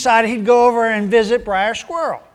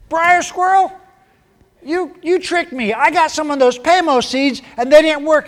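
higher, louder, aggrieved rabbit voice; a cheated rabbit whining at his neighbour; accusing rabbit; 8 semitones higher, 4 dB louder than usual. Acted. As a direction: complain he was tricked, pitched up.

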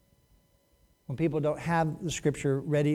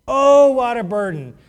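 loud, higher sarcastic groan of a burden; mocking; 6 semitones higher, 9 dB louder than usual. Preacher voices God mimicking grudging worshippers, mocking. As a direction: exaggerate the complaint, loud and mocking. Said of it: "He's almost mocking them".

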